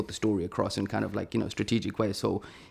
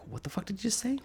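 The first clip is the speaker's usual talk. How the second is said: high, hushed mock-incredulous squeak; stunned inner voice; 12 semitones higher, 8 dB quieter, faster than usual. Storyteller voicing his startled thought at an offer, high hushed disbelief. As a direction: squeal it softly, as if only thinking it. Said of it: "my mind is like".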